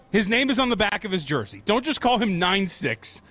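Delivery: ordinary delivery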